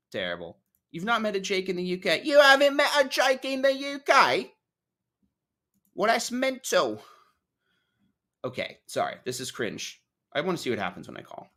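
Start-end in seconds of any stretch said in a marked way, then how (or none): 0.9-2.2 quick, higher put-on British voice; British accent, said briskly. Trying British accent, first take. 2.2-7.0 very high, louder, hammed-up British voice; British accent. Hammed-up second British take.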